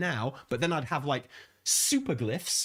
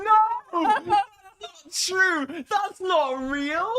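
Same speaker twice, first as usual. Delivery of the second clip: shouted high-pitched laughing protest; laughing disbelief; 7 dB louder, much slower than usual. Real, not acted. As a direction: cry out in amused disbelief. Reacting to absurd voice clip.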